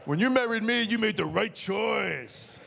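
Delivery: strut it like a hero; swagger. Retold or imitated. Husband boasting to his wife with swagger. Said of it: "I got the swagger".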